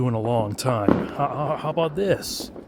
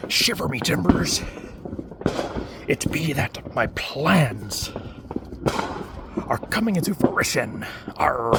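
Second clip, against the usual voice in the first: higher, hammed-up pirate growl; 3 semitones higher than usual. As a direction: play a hammy pirate captain. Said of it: "this sounds more like a pirate".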